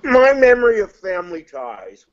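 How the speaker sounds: talking around ice in mouth; words muffled by ice cubes. Real, not acted. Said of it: "I have ice in my mouth".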